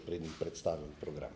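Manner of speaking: ordinary talk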